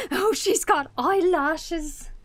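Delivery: gasp, then high, loud and drawn out; high-pitched slow gush of surprise; delighted surprise. Real, not acted. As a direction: gush in amazement at a cute discovery. Reacting to eyelashes on the vacuum.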